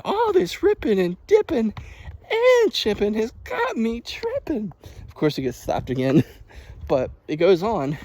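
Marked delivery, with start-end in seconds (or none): 0.0-4.7 high, slow cheeky rhyme; mock grandson. Imitating the grandson's rhyme, high and slow. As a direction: play the cheeky kid. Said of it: "the grandson's like".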